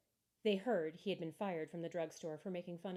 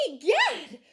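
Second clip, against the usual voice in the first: much higher, louder gasp of shock; startled cry; 10 semitones higher, 15 dB louder than usual. A narrator's reading. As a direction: jump with surprise. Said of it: "cried Jasper".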